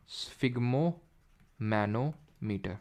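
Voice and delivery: sphygmomanometer very slow, broken into parts; slow careful dictation. Shown as a demonstration. Teacher repeating a hard term for students, sphygmomanometer slowly broken up. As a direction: spell out sphygmomanometer by sound, part by part with pauses.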